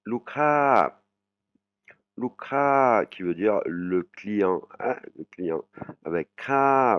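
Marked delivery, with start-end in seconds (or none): 0.0-3.0 Lukha with kha climbing high; rising tone demo. Shown as a demonstration. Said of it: "intonation qui monte". 6.0-7.0 kha said high and rising. Shown as a demonstration. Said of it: "vous voyez cette intonation".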